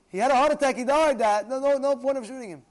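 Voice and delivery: high, loud acted outburst; excited son. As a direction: call it out urgently to Dad. Acts out son telling father excitedly.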